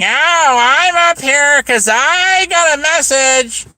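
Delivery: exaggerated high mock quote; mocking imitation. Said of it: "she got up there saying".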